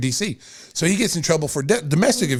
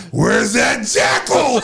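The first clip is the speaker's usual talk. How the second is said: mock shout; 5 semitones higher, much slower than usual. Mocking coach on bus.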